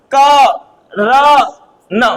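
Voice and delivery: क र न spaced, अ after क and र, none after न; syllable by syllable. Shown as a demonstration. Teacher breaking the name into sounds. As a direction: sound out क र न slowly. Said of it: "क के बाद अ बोला".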